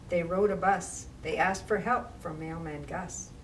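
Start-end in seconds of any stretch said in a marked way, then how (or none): none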